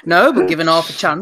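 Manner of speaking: laughing through the reply. Real, not acted. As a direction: caught in a burst of laughter. Laughing at a cheeky joke.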